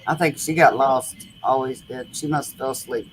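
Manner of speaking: normal talking voice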